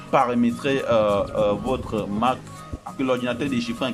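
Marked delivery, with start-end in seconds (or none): none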